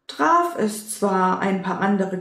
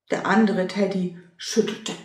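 usual speaking voice

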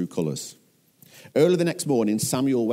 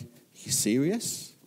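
slow, rising incredulous question; gutted disbelief; 4 semitones higher, 3 dB quieter, slower than usual. Acted. Gutted Samuel answering Saul's boast, incredulous. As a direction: stretch it out in disbelief, pitch climbing. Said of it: "who's gutted, hears this and he's like".

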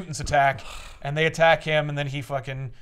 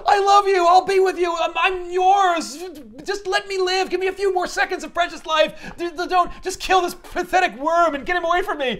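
much higher, loud hammed-up pleading; frantic begging; 14 semitones higher, 4 dB louder than usual. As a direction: grovel to save her own life. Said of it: "She's like".

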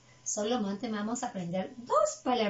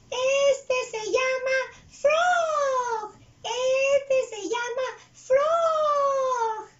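very high, loud puppet voice, drawn out; big stretched squeal on each word; Chuy; 12 semitones higher, 6 dB louder, much slower than usual. Acted. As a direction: repeat the lesson slowly in a squealing character voice. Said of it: "él va a repetir las palabras".

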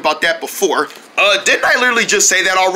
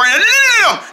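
urgent no; 13 semitones higher than usual. Acted. Urgent refusal.

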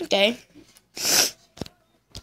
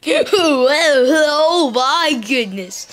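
T-Rex impression; 3 semitones higher, 4 dB louder, much slower than usual. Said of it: "my, um, T-Rex impression".

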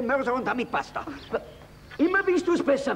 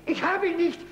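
desperate protest; 7 semitones higher than usual. Acted.

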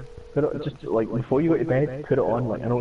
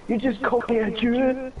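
mock outrage; 12 semitones higher than usual. Voicing an offended friend.

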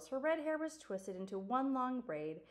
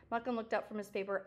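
usual speaking voice

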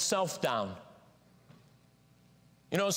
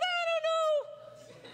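doubting thought; much slower than usual. Pretend. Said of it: "All of us are thinking".

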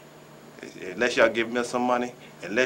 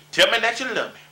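pleading; 10 semitones higher, 3 dB louder than usual. Acted.

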